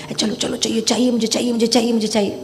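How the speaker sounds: rapid, insistent repetitive nagging; hurried, demanding mind. Acted. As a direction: rattle it off like an impatient inner voice. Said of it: "That's mind's voice".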